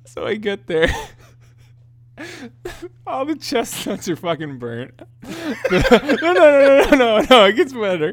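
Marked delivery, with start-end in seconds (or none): 2.2-3.0 squeaky breathless laugh; a sudden high giggle fit; burst of high laughter. Real, not acted. Friend telling story, cracks up with high laugh. 5.7-8.1 louder, higher, laughing through the no's; laughing protest. Real, not acted. Storyteller cuts off friend's laughter, laughing loudly. Laughing while insisting more.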